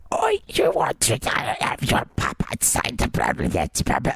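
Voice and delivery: put-on voiceless, rasping whisper with a thick Armenian accent; lost-voice Armenian accent. Clerk answering a customer, with laryngitis and a thick Armenian accent. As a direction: imitate a laryngitic Armenian clerk, words collapsing into babble. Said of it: "who has lost her voice and has a very thick Armenian accent".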